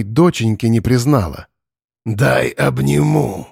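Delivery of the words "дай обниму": slow, menacing, false tenderness; creepy monster coaxing. Acted. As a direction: lure her in like a monster.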